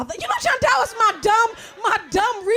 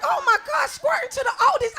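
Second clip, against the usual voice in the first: mock outrage; 7 semitones higher than usual. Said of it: "I was like".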